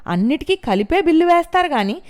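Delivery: high, loud furious tirade; aggressive ranting. A narrator's reading. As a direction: rant at the doctors. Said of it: "దూకుడుగా అలా అరుస్తున్నట్లుగా మాట్లాడుతూ".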